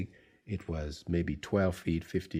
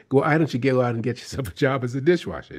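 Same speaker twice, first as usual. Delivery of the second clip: fast, louder scornful jab; angry retort; 5 semitones higher, 6 dB louder, much faster than usual. Retold or imitated. Irate director mocking the failed actor, fast and scornful. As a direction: sneer him out the door quickly. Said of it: "he's really pissed".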